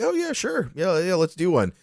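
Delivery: high, hammed-up eager agreement; mock eager yes. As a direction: play your past self overly keen. Quoting his own eager reply.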